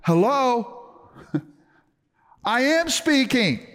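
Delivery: a lighthearted God asking hello; God, amused. Retold or imitated. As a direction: play God as teasingly exasperated, laughing. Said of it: "I feel like God's going".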